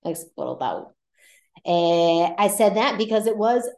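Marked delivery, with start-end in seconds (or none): 0.0-0.9 explotado said softly with the D dropped, explotao. Shown as a demonstration. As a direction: say explotado without the D. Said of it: "so it would sound like explotado".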